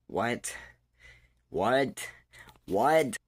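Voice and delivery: can't believe what you just read; disbelief. Real, not acted. Reacting to a confusing comment.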